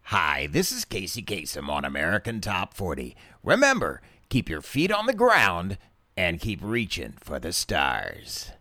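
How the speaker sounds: low radio countdown host with leaping emphasis; Casey Kasem. Acted. Host greeting American Top Forty listeners, low radio DJ voice. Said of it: "here is my Casey Kasem impersonation".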